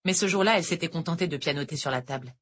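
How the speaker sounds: usual speaking voice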